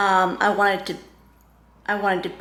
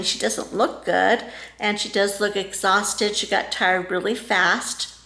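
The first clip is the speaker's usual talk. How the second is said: ordinary talk, nothing marked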